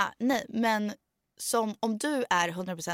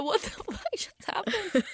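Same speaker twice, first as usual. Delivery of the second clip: much higher, slow, stunned disbelief; mock bewilderment; 11 semitones higher, slower than usual. Imagining her own reaction, slow and stunned. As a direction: drag it out, totally baffled. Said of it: "hade jag varit så här".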